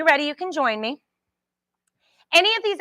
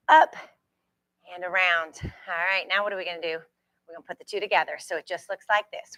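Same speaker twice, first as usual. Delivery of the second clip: usual speaking voice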